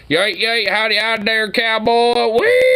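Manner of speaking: high, hammed-up yeehaw cowboy voice; mock cowboy greeting. Mocking the video cowboy with a high howdy. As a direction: prance into a silly cowboy howdy.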